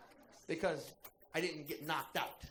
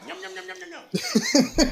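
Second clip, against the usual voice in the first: mock yum yum; 13 semitones higher, 16 dB louder, much faster than usual. Mimics Tommy eating hot dog.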